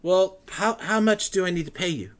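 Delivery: high, loud, flustered scholar voice; excited scholar. Acted. Matrius asks price.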